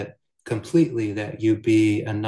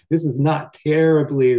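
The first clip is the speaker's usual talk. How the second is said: louder, chuckling understatement; amused chuckle; 6 semitones higher, 8 dB louder than usual. Real, not acted. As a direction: let a laugh spill into the words. Laughs at his own understatement.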